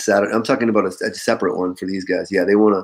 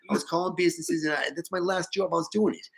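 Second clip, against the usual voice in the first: higher, softer mock New York accent; 7 semitones higher, 6 dB quieter than usual. Boss imitating a New York caller for his team, mock New York accent. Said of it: "The guy sounded like that".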